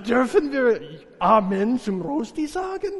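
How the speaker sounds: ordinary delivery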